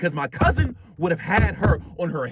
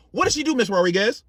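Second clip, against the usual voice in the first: ordinary delivery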